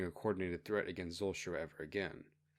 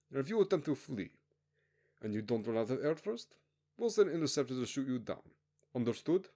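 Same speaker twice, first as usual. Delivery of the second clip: higher, clipped orders to the prisoners; officer briefing convicts on their rigged planes, menacing; stern commanding officer; 5 semitones higher than usual. Acted. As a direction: lay down the rules like a cold officer. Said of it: "the commanding officer said".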